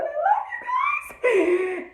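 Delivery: very high, drawn-out squeal with laughter; gushing, laughing adoration; fan squeal. Real, not acted. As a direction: squeal it out, stretched and giddy. Fan gushing about the couple.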